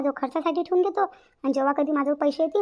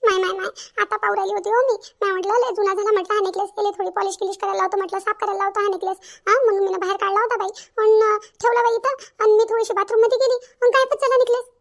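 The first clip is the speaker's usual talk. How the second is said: high, loud, worried mother-in-law; upset mother-in-law; 6 semitones higher, 6 dB louder than usual. Acted. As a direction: explain the loss with rising alarm. (घाबरलेली सासू, उंच आणि मोठ्या आवाजात)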